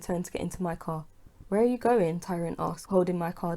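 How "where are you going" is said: called out. A narrator's reading. Tyrone calls after driver.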